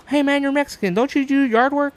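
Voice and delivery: high, loud mocking roommate voice. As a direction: pitch way up to mock the roommate's question. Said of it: "my roommate's like".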